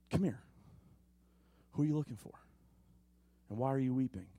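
soft, low, tender asking; gentle invitation. Acted. God inviting the grieving Mary closer, soft and tender. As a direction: coax them closer, gently.

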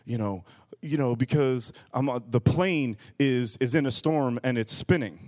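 low, halting tipsy excuse; imitating a drunk's faltering speech; drunk man. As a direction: stumble through an excuse as a drunk. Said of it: "Why are you talking like that".

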